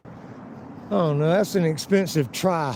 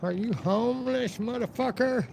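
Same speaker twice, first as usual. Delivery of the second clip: mocking commenters; 7 semitones higher, 7 dB quieter than usual. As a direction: mimic nosy hecklers in a high, quiet voice. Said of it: "all you motherfuckers always coming in".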